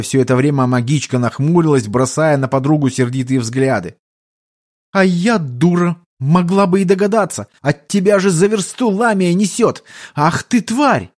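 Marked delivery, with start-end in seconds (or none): 4.9-11.1 raised-pitch indignant outburst; sudden fury. A narrator's reading. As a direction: seethe with rising outrage. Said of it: "ярости беловолосой".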